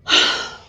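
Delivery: loud exhaled sigh; heavy sigh. Real, not acted. Reviewer gearing up to list gripes, heavy sigh. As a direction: let out a big breath. Exasperated pause before listing gripes.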